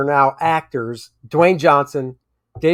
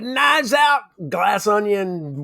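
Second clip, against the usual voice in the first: blurted high, title in the wrong order; eager mix-up of the title; title said backwards; 5 semitones higher, much slower than usual. Retold or imitated. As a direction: announce it with the names flipped. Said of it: "I always do it backwards".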